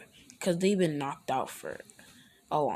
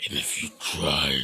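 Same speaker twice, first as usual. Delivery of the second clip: much lower growling demon voice; deep demonic voice; 14 semitones lower than usual. Acted. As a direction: drop into a demon's rumble. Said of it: "going into a deep demonic voice".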